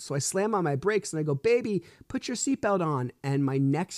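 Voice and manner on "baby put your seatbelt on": retold parental call, pitched high; urgent calling out. Father to daughter as the car moves, urgent. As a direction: call to a child in mild alarm, high-voiced.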